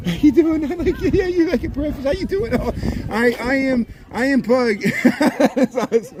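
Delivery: high sing-song pet voice. Real, not acted. Greeting pugs at party.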